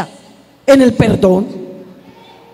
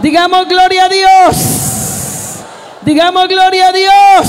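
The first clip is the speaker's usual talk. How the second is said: high, loud preaching shout; shouted praise call; 9 semitones higher, 4 dB louder than usual. Acted. Pastor rousing the congregation to glorify God, full shout. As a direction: belt "Digamos gloria a Dios" out high and loud at the crowd.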